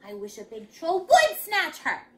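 high, loud fed-up complaint; sigh, then whine it out crossly; exasperated child. A narrator's reading. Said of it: "Peter sighed".

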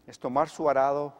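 usual speaking voice

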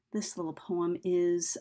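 normal talking voice

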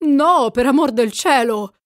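high, loud, strained plea; altered voice. A narrator's reading. Bates begging Ringwood not to call for supper, in an altered voice. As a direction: cry it out in alarm, voice changed. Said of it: "sempre con quella voce alterata".

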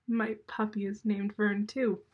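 ordinary delivery

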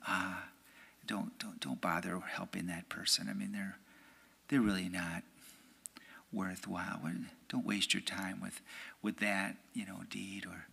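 a hushed, needling tempter; voice of the evil one. Retold or imitated. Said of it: "the voice of the evil one".

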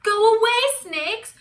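high, loud alarm; panicked. A narrator's reading. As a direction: cry out to drive snakes away. Square panics, sends snakes off.

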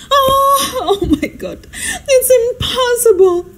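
high, slow theatrical wail; despairing moan. Acted. Hostess giving up on her seating plan, despairing moan.

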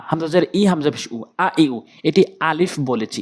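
normal talking voice

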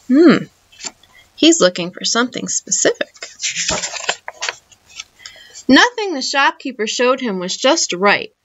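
plain reading voice

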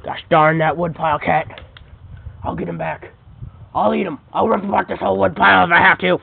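very low growly villain voice; menacing dog villain. Acted. Dog villain plotting against the cat, very low menacing voice. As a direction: drop way down like a brute dog.

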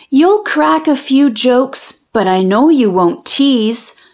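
ordinary delivery

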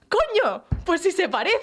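high mock exclamation from a tactless aunt; aunt's blunt outburst. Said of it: "Y va y salta mi tía". (tía soltándolo de golpe, aguda y algo más fuerte)

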